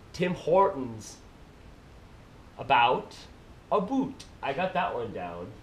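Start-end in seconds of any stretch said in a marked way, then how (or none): 0.1-1.2 slow Canadian "Tim Hortons", tune falling; Canadian accent, draw out "Tim Hortons" and drop the end; practising Canadian accent. Shown as a demonstration. Vlogger practising "Tim Hortons", slow Canadian accent, falling. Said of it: "I'll practice". 2.6-4.2 "About", then Canadian "a boot"; Canadian accent, turn "About" into "a boot"; practising Canadian accent. Shown as a demonstration. Vlogger practising "About", mock Canadian accent. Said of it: "I'll practice".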